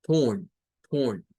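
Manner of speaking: torn said as tun, low; torn, vowel like u with r; low teacher model of torn. Shown as a demonstration. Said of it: "suena como que fuera una U R".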